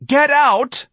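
very high, loud shriek; shrieked order. A narrator's reading. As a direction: scream it at him. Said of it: "Dorian cried".

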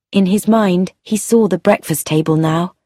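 ordinary delivery, nothing marked

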